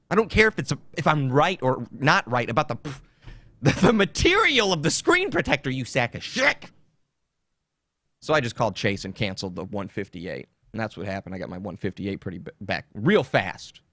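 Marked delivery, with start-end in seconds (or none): none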